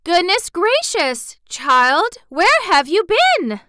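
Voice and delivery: loud, high, drawn-out exclamation; exclaiming. A narrator's reading. Aunt greeting the missing child, exclaiming loudly. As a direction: overdramatic scolding cry, stretched and high. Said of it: "Aunt Em exclaimed".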